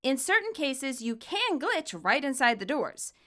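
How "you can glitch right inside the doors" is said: plain reading voice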